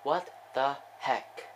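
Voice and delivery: slow, word-by-word repeat; slow disbelief. Real, not acted. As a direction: spell out the bafflement one word at a time.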